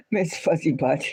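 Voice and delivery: giggling over a pet name; laughing. Real, not acted. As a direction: laugh it out fondly.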